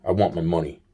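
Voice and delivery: deadpan fake robbery line, low and quiet; mock tough demand. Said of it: "I'm like".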